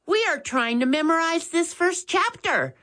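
put-on a young boy reminding his brother; Max's voice. A narrator's reading. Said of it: "Max suddenly noticed".

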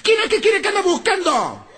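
high, yelled angry wife voice; shrill witch shout. Retold or imitated. Woman on the balcony yelling at the drunk, high screech. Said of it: "Lo grita".

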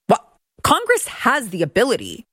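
ordinary delivery

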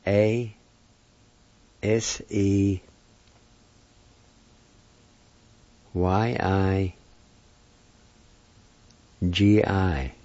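letter by letter, long pauses between; spelled out. Shown as a demonstration. Teacher spelling a word for learners, spelled out. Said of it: "it's spelled like this".

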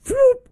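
sudden high whistle-like whoop; mimicking a referee's whistle, loud and high; whistle imitation. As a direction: squeal it out like a ref's whistle. Ref blows whistle for foul.

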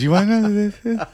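high, sly teasing imitation; sly as the cat who ate the canary; creepy cousin. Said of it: "in that creepy Tyler Milliken's cousin kind of way".

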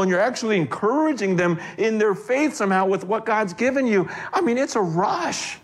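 high, fervent sermon delivery; impassioned preaching. Acted. Preaching about God's gift.

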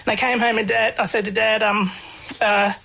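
usual speaking voice

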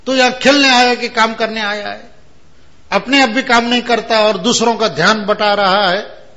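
loud, high angry scolding; scolding minister. Retold or imitated. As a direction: tell him off sharply. (डांटता हुआ मंत्री, ऊंची तेज़ आवाज़)

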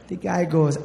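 normal talking voice

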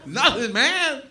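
laughing denial. Acted. Denying with a laugh.

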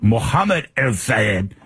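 slow, mock-careful Arabic accent; showy Arabic-accented name; exaggerated Arabic pronunciation. Shown as a demonstration. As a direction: ham up the Arabic sounds of the name. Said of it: "do the full correct pronunciation".

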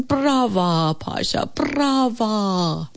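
hushed mock cheer, high and drawn out. A narrator's reading. Everyone applauds Pasha in her dream, cheering. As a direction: cheer like a dreamed audience, without shouting. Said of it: "everyone was applauding and cheering".

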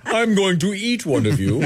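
high-pitched mock menace; Hannibal Lecter. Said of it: "just like Hannibal Lecter looking around going".

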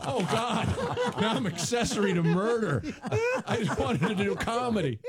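mock despair between laughs; laughing despair. Real, not acted. Comic laughing at being an accessory to murder, mock despair.

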